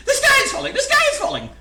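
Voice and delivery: very high, loud squawking alarm; clucking panicked hen. A narrator's reading. Chicken Licken running in circles after something hit her, frantic high clucking. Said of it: "clucked the Chicken Licken".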